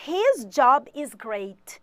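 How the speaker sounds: very slow, words spaced for learners; slow model sentence. Shown as a demonstration. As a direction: drop each word in clearly for the class. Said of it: "teacher models an English sentence".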